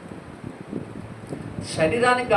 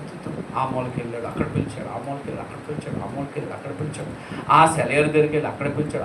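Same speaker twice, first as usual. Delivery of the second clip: hushed storytelling; 5 semitones lower than usual. Acted. Quiet storytelling of search. (మెల్లగా కథ చెప్పడం)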